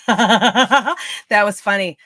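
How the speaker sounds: loud, slow words through real laughter; laughing hard. Real, not acted. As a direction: say it while still cracking up. Still laughing at own wordplay.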